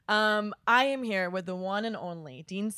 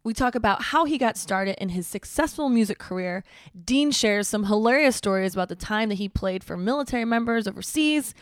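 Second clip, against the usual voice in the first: podcast intro read; 7 dB louder than usual. Acted. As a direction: read it like a radio show opener.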